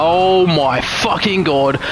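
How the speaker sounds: stunned outburst melting into laughter; slow shocked disbelief. Real, not acted. Driver reacts to a crash with slow shocked swearing.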